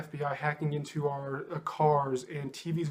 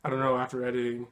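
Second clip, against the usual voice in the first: ordinary talk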